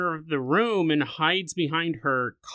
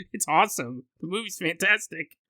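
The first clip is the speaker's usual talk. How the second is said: ordinary talk, nothing marked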